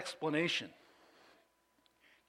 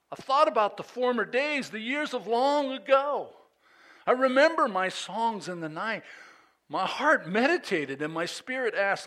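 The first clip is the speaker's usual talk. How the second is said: raised pitch, louder delivery; dramatic scripture reading; 6 semitones higher, 5 dB louder than usual. Acted. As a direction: read the psalm out as a lament. Reads the psalm aloud.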